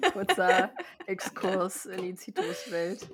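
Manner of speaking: laughing loudly while speaking. Real, not acted. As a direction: burst out laughing, then speak. Laughing at citrus tangent.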